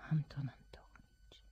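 slow, hushed, awed; a hushed, slow whisper of the director's name. Acted. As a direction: breathe the name slowly.

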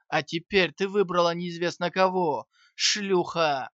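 loud, frantic, unhinged yelling; feral wasteland raider. Acted. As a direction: rage at her like a broken man. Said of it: "безумно заорал Кармон". (безумный яростный крик)

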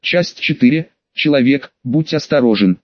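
ordinary delivery, nothing marked

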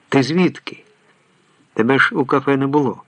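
mildly thrown, asking in confusion; bewildered. A narrator's reading. Hans asks his friend where he came from, bewildered. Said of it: "В його голосі звучить збентеження".

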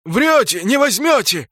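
high, loud cry while fleeing; defiant shout. A narrator's reading. Orc shouts at pursuers as he flees, defiant. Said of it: "закричал он".

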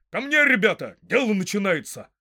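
high, loud summons; rallying shout. Acted. Farmer summoning his sons, rallying shout. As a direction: call the lads to action.

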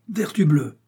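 high, loud oath; oath cried out. A narrator's reading. Porthos swearing at the man tangled in his cloak. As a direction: curse loudly, tangled in the cloak. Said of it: "cria Porthos".

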